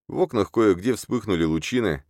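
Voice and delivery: ordinary delivery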